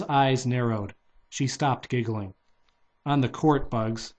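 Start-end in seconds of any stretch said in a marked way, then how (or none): none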